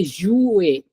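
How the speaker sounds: jouer slowly as zhoo-AY; syllable demonstration. Shown as a demonstration. Teacher has student count syllables, slow two-part word. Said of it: "how many syllables I'm speaking".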